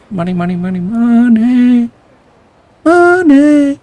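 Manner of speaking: from plain to a loud, high, strained cry; building money rant. Acted. Saejima alone, brooding about money, building to a loud cry.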